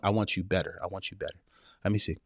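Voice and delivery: usual speaking voice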